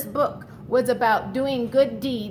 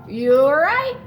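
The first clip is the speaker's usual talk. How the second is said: plain reading voice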